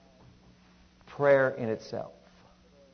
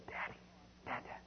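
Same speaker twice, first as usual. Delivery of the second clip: very soft imitation of a little daughter; hushed little calls; tender child's call; 20 dB quieter than usual. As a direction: nearly whisper it, fondly. Imitates daughter looking down to him.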